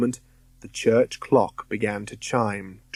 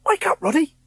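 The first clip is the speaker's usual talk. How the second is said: urgent hiss; faster than usual. Acted. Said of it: "William Povey hissed".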